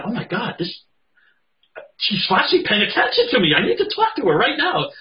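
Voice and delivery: high, loud, breathless-with-news exclamation; urgent, excited outburst. Acted. Body part finally getting attention, urgent high excitement.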